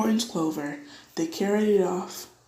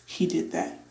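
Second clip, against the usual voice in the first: ordinary delivery, nothing marked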